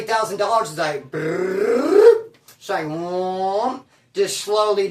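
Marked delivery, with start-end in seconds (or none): 1.1-2.3 rising rolled brrr, getting louder; imitated whirring noise. Showing thirty thousand dollars vanishing, whirring noise. Imitates money draining away. 2.8-3.8 long drawn-out, rising whoom; imitated whoosh. Showing the bank money draining away, slow whoom.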